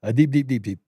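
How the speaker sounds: a hammed-up Indian accent on the repeated word; quick mock accent, regretted at once; put-on Indian accent. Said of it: "I shouldn't have said it like that".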